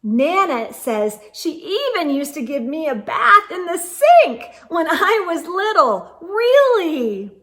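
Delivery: ordinary delivery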